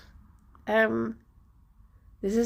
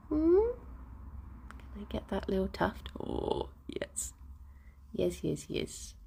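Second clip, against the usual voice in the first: hushed pet talk, much softer, fast on the question; soft cooing; 9 dB quieter than usual. Real, not acted. Streamer softly cooing to her bunny while stroking it. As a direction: coo quietly to the bunny.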